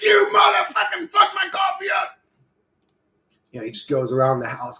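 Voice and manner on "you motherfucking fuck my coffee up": very high, strained angry yell; mock furious scream. Coffee drinker cursing the little zombie man, mock furious scream. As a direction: scream it in hammed-up rage.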